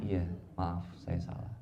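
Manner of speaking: low, soft admission; acted husband calmly apologising; kind apology. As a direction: yield meekly, dropping the voice. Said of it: "suami balasnya dengan kebaikan".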